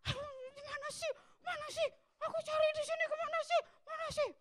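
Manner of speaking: high, whiny frantic complaining; a teenage son fretting over his lost drama script, high and whiny; teen throwing a tantrum. Retold or imitated. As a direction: imitate a teen stomping around for a lost script. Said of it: "Oh, marah-marah".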